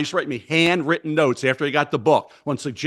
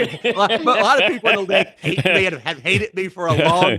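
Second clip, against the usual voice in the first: laughing; 4 semitones higher than usual. Real, not acted. Laughs admitting people hate him.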